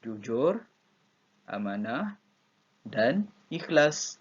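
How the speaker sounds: "Jujur," then a pause, "amanah," then a pause, then a slow "dan ikhlas"; slow model reading with a clear break at each comma. Shown as a demonstration. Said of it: "Di bahagian koma mesti ada pause sekejap".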